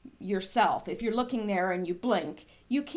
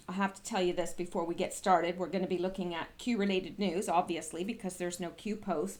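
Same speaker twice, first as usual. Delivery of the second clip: ordinary talk, nothing marked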